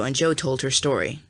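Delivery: plain reading voice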